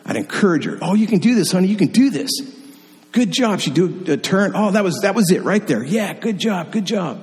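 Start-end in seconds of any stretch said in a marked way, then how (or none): 0.8-3.6 high, bright cheering for his daughter; dad cheering his daughter on the ski slope, pitched high; encouraging. Retold or imitated. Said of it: "In love and humor, I'd encourage her". 4.5-7.2 encouraging praise. Retold or imitated. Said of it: "In love and humor".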